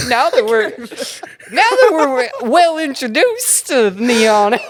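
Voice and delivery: goofy cartoon voice, hammed right up. Co-hosts introducing the show in Goofy voices. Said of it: "gotta do it in the Goofy accent".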